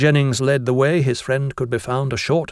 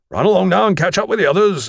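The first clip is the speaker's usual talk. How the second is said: fast, higher-pitched teacher's command; brisk order; 7 semitones higher, 4 dB louder, faster than usual. A narrator's reading. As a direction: send the boys off briskly. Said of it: "he ordered".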